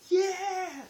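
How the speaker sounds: high, loud whooped yeah; retold cheer. Crew climbing out to go home, cheering. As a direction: whoop it out in celebration.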